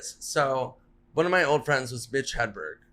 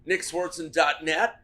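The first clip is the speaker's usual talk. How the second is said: ordinary talk, nothing marked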